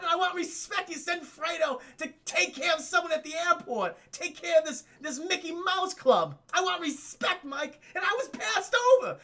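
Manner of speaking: high, loud whiny Fredo rant; Fredo's outburst. Retold or imitated. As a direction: shout it like the passed-over brother. Streamer acts out Fredo's outburst.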